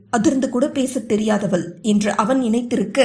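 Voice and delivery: ordinary delivery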